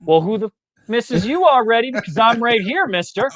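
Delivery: high, mock-indignant wife's retort; sassy wife. Wife confronting her cheating husband, mock indignant and high. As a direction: sass him like the wife who caught him.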